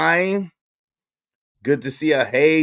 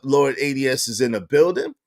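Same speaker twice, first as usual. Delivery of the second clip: usual speaking voice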